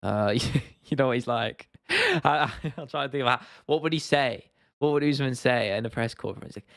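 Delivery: ordinary delivery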